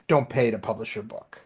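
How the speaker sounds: ordinary talk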